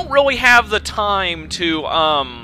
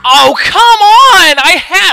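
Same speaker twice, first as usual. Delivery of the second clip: long drawn-out shout, high and loud; frustrated shout; 11 semitones higher, 10 dB louder, slower than usual. Real, not acted. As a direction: yell it at the screen in disbelief. Frustrated gamer yelling at screen.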